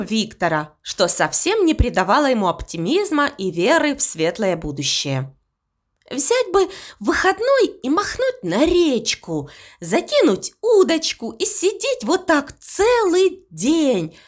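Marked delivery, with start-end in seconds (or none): 6.1-14.1 dreamy wistful. Acted. Death dreaming of a day off.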